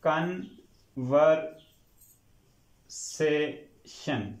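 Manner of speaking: "Conversation" very slowly in four separated syllables, r sounded. Shown as a demonstration. Teacher splits the spelt word for students, syllable by syllable. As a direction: break "conversation" into con, ver, say, shun with long pauses. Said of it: "अगर इसके syllable अलग-अलग करें".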